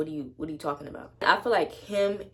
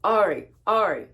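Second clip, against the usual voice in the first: loud, high bossy calling of the name; loud mocking manager voice; imitating the manager; 4 semitones higher, 8 dB louder than usual. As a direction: mimic the manager calling you out. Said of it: "And then she got".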